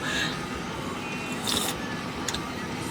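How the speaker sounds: short breathy laugh. Real, not acted. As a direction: laugh at the sour hit. Reacts to the sour taste.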